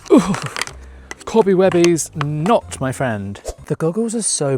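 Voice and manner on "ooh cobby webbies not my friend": louder cutesy disgust. Real, not acted. Clearing cobwebs, cutesy mock-disgusted voice. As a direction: coo at the cobwebs in mock horror. Squeamish baby talk at cobwebs.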